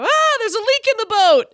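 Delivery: high, loud mock-panicked yell; retold scream. Host acting out a cartoon character on the river, mock scream. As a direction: cry out a hammed-up alarm. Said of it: "somebody screams and go".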